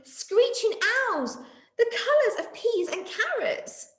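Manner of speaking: high, slow alarmed voice; startled by strange birds. Acted. Monkey startled by parrots.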